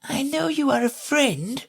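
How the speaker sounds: high tiny insect voice; a very small voice right at her ear. A narrator's reading. Tiny gnat voice teasing Alice at her ear. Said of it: "the little voice went on".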